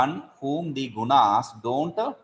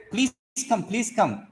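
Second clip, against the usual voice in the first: happy welcome; 9 semitones higher than usual. Retold or imitated. Host greets an unwelcome visitor with forced cheer. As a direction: fake cheerful please come, higher. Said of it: "Happily, I said".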